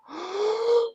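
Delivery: sharp, high gasp of wonder; excited gasp. Retold or imitated. Blind boy feeling Vader's mask, a high excited gasp. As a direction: suck in a big amazed breath. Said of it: "he was like".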